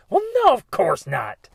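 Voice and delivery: loud, high burst of protest; emphatic mock denial. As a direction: blurt it out as an offended listener. Acts out the listeners' indignant answer.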